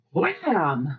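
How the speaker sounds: Wham stretched out with a big swooping pitch; corrected Wham. Retold or imitated. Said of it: "No, you're saying it wrong".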